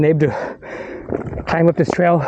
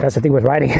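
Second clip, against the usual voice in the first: ordinary talk, nothing marked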